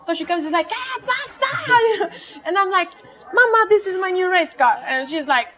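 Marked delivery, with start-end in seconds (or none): 0.7-2.1 high, drawn-out retold shriek; mock shocked shriek. Mom finds a Subaru on the patio, mock shriek. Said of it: "she starts shouting". 3.4-4.8 high, quick daughter's plea; acting out an insistent daughter; pleading retold announcement. Daughter explains the car to mom, high and insistent. Acted quarrel with her mother.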